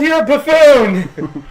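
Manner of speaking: very loud, high-pitched shout; outraged comic yell. Acted. Player's character to Greer in a quarrel, hammed-up furious yell. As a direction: scream the insult back, stretching it out. Acted insult yelled back at Greer.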